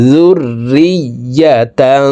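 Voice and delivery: জুররিয়াতাং with the ending nasal hidden and held; nasal ghunna. Shown as a demonstration. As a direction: stretch the final তাং through the nose, very slow. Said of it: "নাকের ভিতরে লুকাইয়া গুন্নার সহিত পড়তে". (তানউইনে গুন্না)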